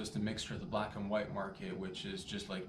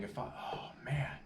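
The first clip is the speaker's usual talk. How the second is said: whispered aside; whispering; 5 dB quieter than usual. Real, not acted. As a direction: drop to a whisper.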